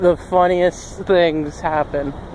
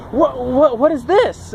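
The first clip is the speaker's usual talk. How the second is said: higher-pitched, stammered confused question; mock bafflement; 6 semitones higher, slower than usual. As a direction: play a tourist baffled by what they see. Acting confused tourist asking in France.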